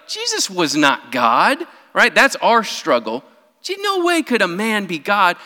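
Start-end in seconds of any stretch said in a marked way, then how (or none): 3.6-5.4 high, incredulous "no way"; mock disbelief. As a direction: scoff like a modern skeptic. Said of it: "Today, it's like".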